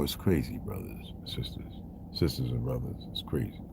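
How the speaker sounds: breathy whisper, dropped way down; whispered aside. Real, not acted. Telling his brothers and sisters it's crazy, in a whisper. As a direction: lean in and whisper it like a secret.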